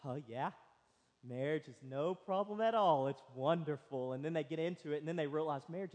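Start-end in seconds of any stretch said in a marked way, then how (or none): 0.0-4.1 mock sarcastic agreement. The old married couple teasing newlyweds, sarcastically. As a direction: lower and softer, dry teasing sarcasm. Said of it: "and we're like".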